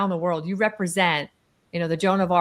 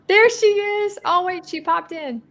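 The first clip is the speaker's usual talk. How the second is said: excited greeting; 13 semitones higher, 5 dB louder, slower than usual. Real, not acted. Greeting guest joining the call.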